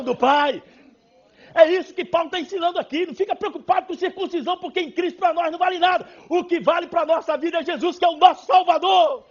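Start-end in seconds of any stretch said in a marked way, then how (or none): 1.5-9.3 loud, high preaching push; fiery preaching. Acted. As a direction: preach it out to the whole church. Preaching at full force. (pregação inflamada, alta e forte)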